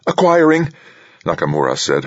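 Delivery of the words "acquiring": very high, tense call; voice edging higher. A narrator's reading. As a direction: report tensely, pitched high and louder. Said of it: "her voice edging higher".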